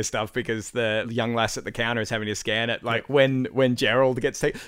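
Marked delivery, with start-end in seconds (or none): none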